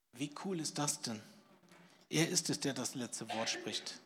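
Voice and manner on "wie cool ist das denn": usual speaking voice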